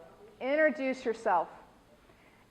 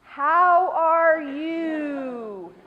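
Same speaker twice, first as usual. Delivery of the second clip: slow, higher, louder patronizing sing-song; placating sing-song; 4 semitones higher, 7 dB louder, much slower than usual. Shown as a demonstration. Teacher demonstrating a patronizing greeting to students. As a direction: talk down like to a toddler. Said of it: "But don't placate. Don't go".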